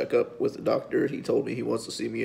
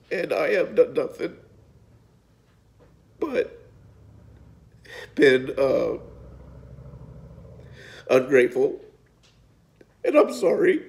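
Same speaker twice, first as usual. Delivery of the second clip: louder, breaking upward on the apology; emotional, near tears; 3 semitones higher, 3 dB louder than usual. Real, not acted. Owning his ingratitude to his mom, voice breaking. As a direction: voice cracking with real regret. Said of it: "I didn't mean to get emotional".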